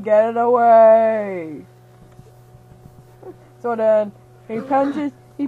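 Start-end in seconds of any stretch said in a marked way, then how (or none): 0.0-1.6 louder, dragged-out get it away; loud slow yell. Acted. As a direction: bellow it slowly at the broccoli. Angry hero refusing broccoli.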